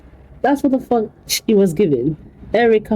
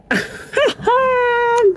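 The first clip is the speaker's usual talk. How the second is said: laugh. Real, not acted. Laughing in disbelief at the skit.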